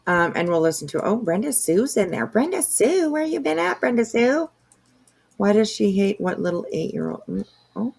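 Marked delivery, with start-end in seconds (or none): 2.3-4.5 calling out much higher, singsong; happy, high hello to a regular; excited greeting. Real, not acted. Streamer greets a chat regular, high and excited.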